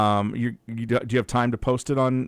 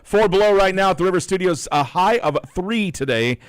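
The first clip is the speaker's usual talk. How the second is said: louder, upbeat announcer read; radio announcer read; 4 semitones higher, 8 dB louder than usual. Acted. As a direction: read the weather to listeners on air. On-air weather and phone read.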